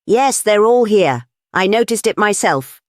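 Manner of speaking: high, eager outburst; excited cry. A narrator's reading. Young duke answers the colonel, crying out eagerly. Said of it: "cried the young duke".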